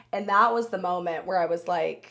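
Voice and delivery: usual speaking voice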